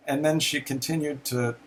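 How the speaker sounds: ordinary talk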